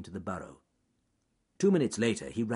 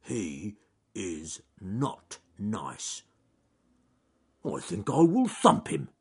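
slow bear character; 4 dB louder, slower than usual. Acted. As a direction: think it out slowly, then resolve firmly. Slow bear thinking aloud.

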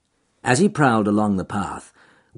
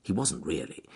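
ordinary delivery

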